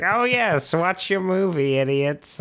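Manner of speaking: high, sneering ghoul voice; sneering Crypt Keeper. Retold or imitated. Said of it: "He's like".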